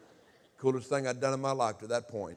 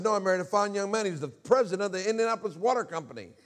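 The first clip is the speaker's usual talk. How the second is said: high, louder imitation of a defensive mom; retold indignant defense; 8 semitones higher, 4 dB louder than usual. Mother defends new husband to son, acted indignant. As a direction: stand up for him, pitch raised.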